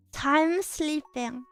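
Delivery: normal talking voice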